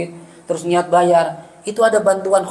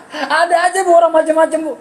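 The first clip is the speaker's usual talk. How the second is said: exasperated exclaim; 9 semitones higher, 3 dB louder than usual. Real, not acted. Exclaiming at audience.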